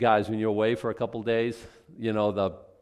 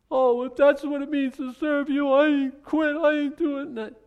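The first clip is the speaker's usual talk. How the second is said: much higher, thin whining voice; whiny mock complainer; 13 semitones higher, 3 dB louder than usual. Preacher mocking a quitter, high whiny voice. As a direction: whine like someone quitting on God.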